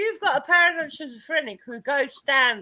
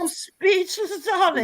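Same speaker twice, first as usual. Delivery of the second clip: camp mock shock; 6 semitones higher, slower than usual.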